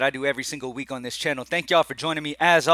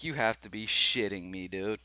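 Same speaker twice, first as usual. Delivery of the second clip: softer, lower deadpan mutter of disbelief; 4 semitones lower, 7 dB quieter than usual. Retold or imitated. Reacting to the blown bet, muttered disbelief. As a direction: say it flat and done, like the bet just died. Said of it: "And I'm like".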